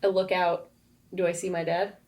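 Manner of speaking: usual speaking voice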